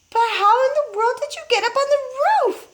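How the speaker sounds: a stunned mother crying out. A narrator's reading. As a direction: cry out in disbelief. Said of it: "cried the mother".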